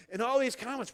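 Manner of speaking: usual speaking voice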